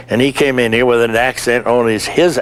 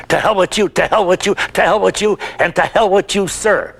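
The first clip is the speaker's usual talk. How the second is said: retold yelling back; 7 semitones higher than usual. Actor firing the director's words back at him, mock yell. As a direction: throw it back at him in a hammed-up yell, higher. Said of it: "So I ran back at him".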